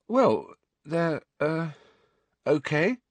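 high, halting, unsure answer; nervous. A narrator's reading. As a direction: search nervously for a safe word. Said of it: "said Neville nervously".

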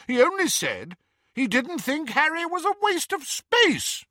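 very high, incredulous protest; indignant. A narrator's reading. Hestia outraged on Harry's behalf at faint praise, indignant. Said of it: "said Hestia indignantly".

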